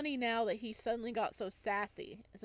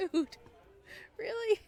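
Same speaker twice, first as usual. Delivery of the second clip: much higher and louder, laughing out; laughing disbelief; 12 semitones higher, 7 dB louder than usual. Acted. Friend fails at elbow lick.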